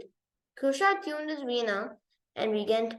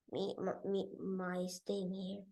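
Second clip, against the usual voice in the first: dejected murmur; 5 semitones lower, 14 dB quieter, slower than usual. Acted. Kusha despondent.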